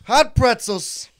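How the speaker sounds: stadium vendor hawking. Pretend. Mock stadium vendor calls.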